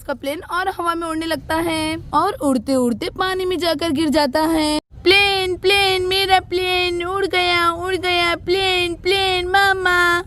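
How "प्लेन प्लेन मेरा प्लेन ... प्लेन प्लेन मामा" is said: put-on a little boy whose toy just flew away; upset toddler. Toddler loses plane in water.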